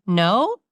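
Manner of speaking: NO rising like a question; question inflection. Acted. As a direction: answer NO as if asking. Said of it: "accidentally use the question inflection".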